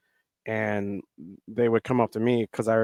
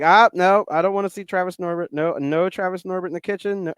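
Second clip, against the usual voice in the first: retold scolding; 6 semitones higher than usual. Wife banning the character voice at home, mock scolding. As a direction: imitate a spouse putting a foot down. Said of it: "I usually get like".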